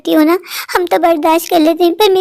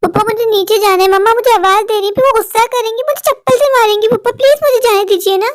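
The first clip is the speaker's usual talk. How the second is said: put-on a scared boy begging his father; pleading child; 3 semitones higher than usual.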